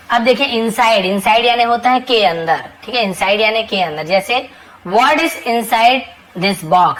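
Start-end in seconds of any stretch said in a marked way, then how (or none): 4.9-6.9 very slow example sentence; slow teacher demo; slow dictation. Shown as a demonstration. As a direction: spell it out slowly for the class. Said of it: "slow model sentence for learners".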